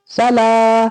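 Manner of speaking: "सला" with the final aa held long; elongation. Shown as a demonstration. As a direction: stretch out the laa of "सला". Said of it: "इसको खींचा जाएगा".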